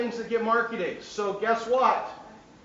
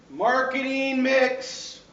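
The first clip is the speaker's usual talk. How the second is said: each word stretched out slowly; slow and emphatic; 4 semitones higher, 4 dB louder, much slower than usual. Real, not acted. Teacher stressing an exam term to students, slowly and emphatically. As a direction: spell it out for note-takers.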